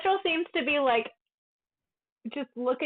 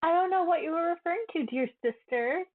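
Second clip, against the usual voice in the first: fast, higher, feigned innocence; mock innocent; 7 semitones higher than usual. [name] to her sister, fast mock-innocent voice. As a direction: play dumb sweetly and quickly.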